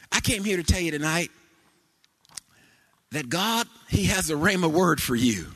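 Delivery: high, loud whooping sermon cadence; preaching. Acted. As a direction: build the congregation up with each line. Preaching at full force with organ.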